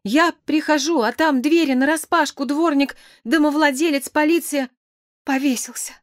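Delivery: high-pitched distraught wail, acted; weeping character's breathless-with-grief report; sobbed account. A narrator's reading. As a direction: pour out the news tearfully, voice pitched right up. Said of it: "прорыдал Петя".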